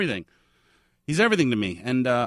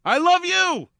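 higher and louder, gushing back; 5 dB louder, slower than usual. Real, not acted. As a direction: throw the love right back, beaming. Returning declaration, warmly overdone.

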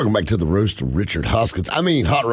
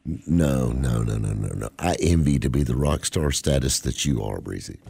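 trailing off softer and lower; mock humble; 8 semitones lower, 5 dB quieter than usual. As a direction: fade into mock modesty. Mock humble fading away.

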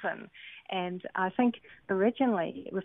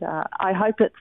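normal talking voice

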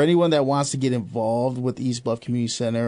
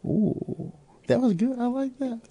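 high, delighted ooh; impressed; 10 semitones higher, 5 dB quieter than usual. Real, not acted. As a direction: react with real delight. Impressed by the answer.

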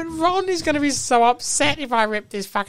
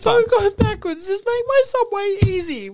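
entitled customer; 8 semitones higher, 4 dB louder than usual. Acted.

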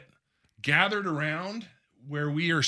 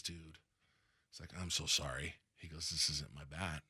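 very low, soft retold apology; imitating a hushed, regretful verdict; feigned sympathy; 12 semitones lower, 14 dB quieter than usual. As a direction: break bad news gently, voice dropped. Retells Griffey feigning bad news.

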